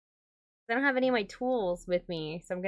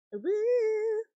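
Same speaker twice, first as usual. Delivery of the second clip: high, held whoop; celebratory woo; 12 semitones higher than usual. Real, not acted. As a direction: let out a high little cheer. Celebrates the finished outfit.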